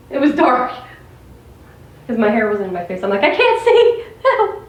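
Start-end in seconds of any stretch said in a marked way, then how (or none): 3.2-4.6 high whiny mock cry for help; mock distress. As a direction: squeak it out like a helpless child. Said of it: "I was joking".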